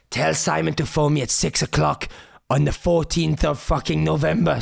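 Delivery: slow put-on voice of the caller; imitating Tommy. Retelling Tommy's phone call, slow imitating voice. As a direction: mimic the manager's drawn-out phone voice. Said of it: "and he said".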